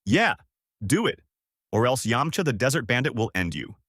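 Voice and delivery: ordinary delivery, nothing marked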